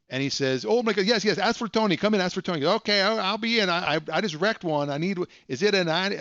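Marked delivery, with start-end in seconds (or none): 0.7-2.7 high, loud, overeager yes-yes; eager salesman. Retold or imitated. Tony eager to sell. 2.7-6.2 prank caller voice. Retold or imitated. Prank caller keeps going.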